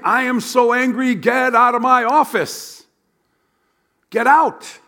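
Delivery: high, loud angry shout at Moses; God shouting him out of the office; furious God. Retold or imitated. Preacher voicing an angry God ordering Moses out. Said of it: "this is what God is saying".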